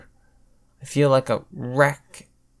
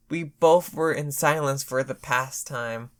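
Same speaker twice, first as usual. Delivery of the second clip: plain reading voice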